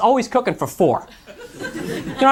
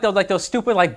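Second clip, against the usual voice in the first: ordinary talk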